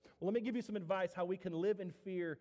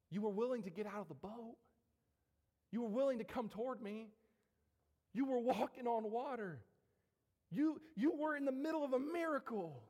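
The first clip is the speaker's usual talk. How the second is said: incredulous reminder, climbing higher; building reproach; 4 semitones higher than usual. Acted. Jesus to sinking Peter, building in disbelief. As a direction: build step by step to a high peak.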